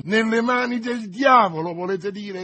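loud, high, defiant retort. A narrator's reading. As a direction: raise the voice to be overheard. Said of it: "alzando la voce per attirare gente".

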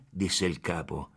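ordinary delivery

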